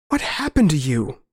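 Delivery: just manage to get the question out; barely managed plea. Acted. Narrator backing away from his old friend, barely managed plea. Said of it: "I managed".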